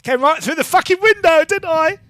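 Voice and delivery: high, gleeful boast; bragging. Acted. Gang girl bragging about smashing the window. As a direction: show off about it.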